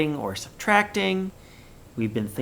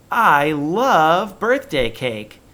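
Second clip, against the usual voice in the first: slow, loud, delighted exclamation; excited; 4 semitones higher, 7 dB louder, much slower than usual. Shown as a demonstration. Teacher showing kids an exclaiming voice, excited. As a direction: gush over the cake. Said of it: "use an exclamation point".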